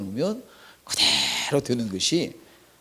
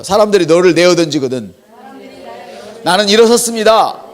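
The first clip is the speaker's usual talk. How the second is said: loud call and response; 5 semitones higher, 7 dB louder than usual. Shown as a demonstration. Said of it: "한번 따라할까요".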